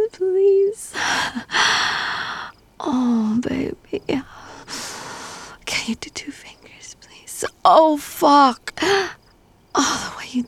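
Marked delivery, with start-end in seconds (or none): none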